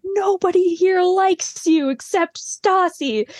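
drawn-out, high-pitched mock taunt; hammed-up jeer, dragged out slowly; Jax. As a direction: imitate a taunting troublemaker, high and slow. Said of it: "And Jax is like".

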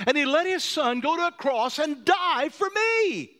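loud, high preacher's cry; impassioned sermon peak; full-force preaching. Acted. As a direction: drive it home with force. Preaching climax.

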